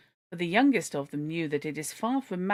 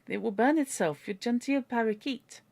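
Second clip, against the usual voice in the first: worried French lady; 6 semitones higher than usual. A narrator's reading. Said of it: "cried the lady".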